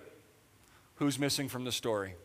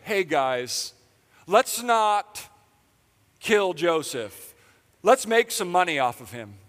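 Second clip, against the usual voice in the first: higher and louder, cheerful scheming pitch; mock Judah; 4 semitones higher, 5 dB louder, slower than usual. Pastor mocking Judah pitching the sale, chirpy schemer. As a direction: sell it like a bright idea.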